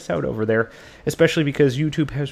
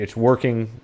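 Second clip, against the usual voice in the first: ordinary talk, nothing marked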